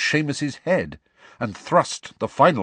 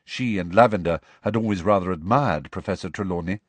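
ordinary delivery, nothing marked

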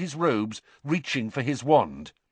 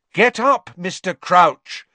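much higher and louder, slow and clear; 8 semitones higher, 9 dB louder, slower than usual. Acted. Harry urges the fallen Crouch to stand, loud and clear. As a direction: say it loud so a dazed man hears. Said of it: "said Harry loudly and clearly".

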